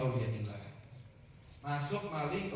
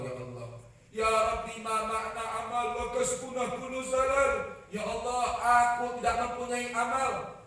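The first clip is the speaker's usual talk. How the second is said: raised, pleading prayer to Allah; 3 semitones higher than usual. Acted. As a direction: plead with God, drawn out. Preacher opens with chanted prayer. (doa memohon dengan suara memelas)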